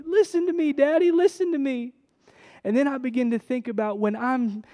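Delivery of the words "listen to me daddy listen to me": put-on child demanding attention. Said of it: "and she says".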